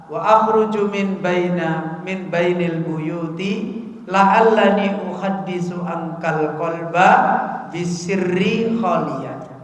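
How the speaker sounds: slow, drawn-out recitation of Arabic verse; slow poetic recitation; reciting a poem. Acted. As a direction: savour each line of the verse. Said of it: "dengan membaca syair".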